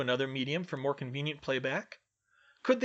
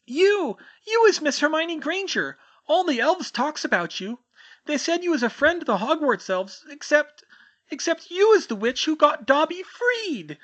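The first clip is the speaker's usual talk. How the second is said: very high, loud trembling elf squeak; timid house-elf greeting a stranger, quivering squeak; 9 dB louder than usual. Acted. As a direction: squeak it out, small and nervous. Winky recognises Hermione.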